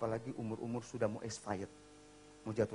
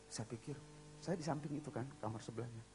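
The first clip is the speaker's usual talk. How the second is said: usual speaking voice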